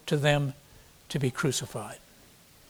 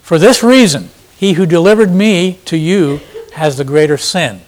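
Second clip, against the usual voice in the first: forceful; 11 dB louder than usual. Acted. Jesus voiced answering Pilate.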